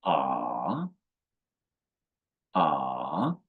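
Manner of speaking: "Ah" dipping low, then rising; drawn-out "Ah" that sinks before it climbs; third tone. Shown as a demonstration. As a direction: scoop "Ah" down and back up. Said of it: "falling first, then rising".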